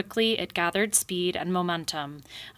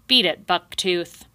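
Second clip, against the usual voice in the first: gruff shout; 8 dB louder than usual. Acted. Porcupine chasing off beaver.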